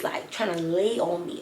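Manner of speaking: usual speaking voice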